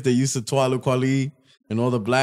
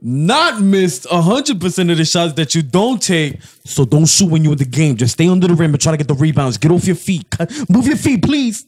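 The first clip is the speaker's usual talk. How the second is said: mock blunt coach; 2 semitones higher, 4 dB louder than usual. Retelling the coach's rebound advice, loud mock coach. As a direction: ham up a harsh coach. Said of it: "You said it too positive".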